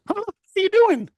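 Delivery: a squeaky, startled Mickey-fan roommate. Retold or imitated. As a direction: play the roommate as a cartoonish surprised nerd. Roommate voiced in retold story.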